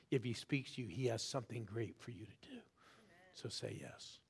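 softly whispered plea; hushed urging. Real, not acted. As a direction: drop to a whisper to draw them in.

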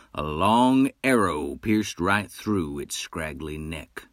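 plain reading voice

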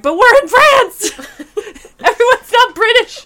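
very high, loud mock-outraged yell; exasperated protest. Viewer protesting British accents in films, exasperated. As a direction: shout it in comic disbelief.

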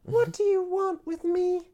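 high scared prisoner. Acted. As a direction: ask high and worried.

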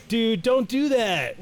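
high, slow whiny plea; squeaky mock "dude, don't do that". Acted quote in retold story.

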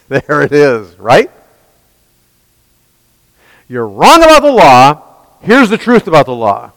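fiery sermon delivery; preaching. Acted. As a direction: preach it hard to the room. Preaching at full force.

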